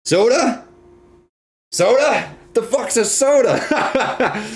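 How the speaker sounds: high-pitched, incredulous questioning; mock disbelief. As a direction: act baffled by a foreign word. Mock disbelief at the word soda.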